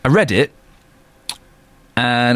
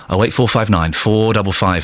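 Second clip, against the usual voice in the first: usual speaking voice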